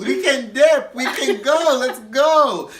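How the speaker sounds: put-on high, drawn-out drunken yelling; loud, insistent mock drunk shout. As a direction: holler it like a drunk friend hurrying everyone out. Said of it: "Donette drunk".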